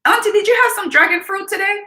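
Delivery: put-on high, fast little-girl voice; imitating a five-year-old checking up on her aunt; niece's voice. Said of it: "she said to me".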